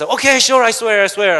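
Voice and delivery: a hungry brother giving in fast; eager agreement. Retold or imitated. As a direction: blurt it out, starving. Starving Esau agreeing eagerly.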